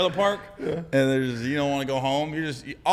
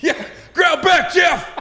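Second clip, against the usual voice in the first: high, loud, slow shout; shouted urging; 9 dB louder, slower than usual. Retold or imitated. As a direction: yell encouragement. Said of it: "I said".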